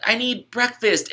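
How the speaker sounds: put-on high, slow child asking for breakfast; a small kid pulling at a parent; little kid. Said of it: "Your kid runs up".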